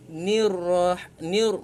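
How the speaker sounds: ordinary delivery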